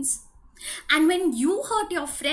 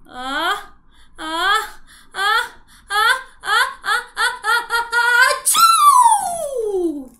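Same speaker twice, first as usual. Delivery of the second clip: ah-ah-ah rising higher and louder until the big sneeze; building sneeze into loud achoo; 8 semitones higher, 8 dB louder, slower than usual. Acted. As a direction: build the sneeze up and let it burst.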